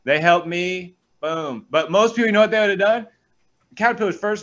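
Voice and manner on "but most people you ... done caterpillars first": excited. Real, not acted. Excited rant about farmers.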